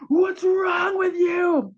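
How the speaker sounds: put-on sudden high, loud, slow yell at the kid; angry parent shout. Parent yelling at a child who wrecked the kitchen, high loud shout. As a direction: bellow it like a furious parent. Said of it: "we're gonna shout with our jaw, this Cro-Magnon face".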